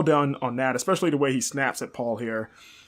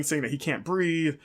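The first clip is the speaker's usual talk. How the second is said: ordinary talk, nothing marked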